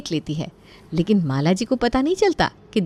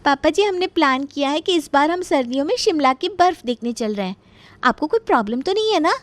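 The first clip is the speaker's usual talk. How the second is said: higher-pitched, cheerful announcing; cheeky daughter-in-law; 7 semitones higher than usual. Acted. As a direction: sweetly ask father-in-law's leave. Daughter-in-law announces trip to father-in-law.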